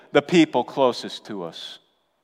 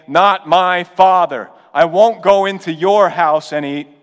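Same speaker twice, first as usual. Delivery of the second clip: defiant son rejecting his father; defiant rejection; 4 semitones higher, 6 dB louder than usual. Retold or imitated. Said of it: "is he saying".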